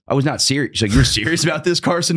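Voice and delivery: lightly voiced mom protesting; mom's objection. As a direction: mom pushing back at the dad. Mom voiced in family fight.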